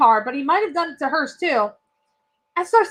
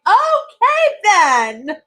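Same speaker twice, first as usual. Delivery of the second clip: high, loud lilting "okay" dropping on "then"; taken-aback okay; 5 semitones higher, 4 dB louder, much slower than usual. Retold or imitated. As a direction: swoop up high, then drop for the last word. Boss reacts to rude guest.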